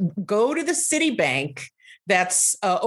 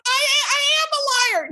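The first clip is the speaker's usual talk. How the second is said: roommate's admission; much faster than usual. Retold or imitated.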